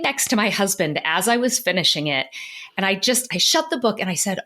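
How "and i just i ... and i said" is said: normal talking voice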